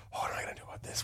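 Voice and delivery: hushed, unvoiced worried whisper; a startled whisper from half asleep; panicked whisper. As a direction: whisper it to yourself in a daze. Retold panicked inner thought on waking.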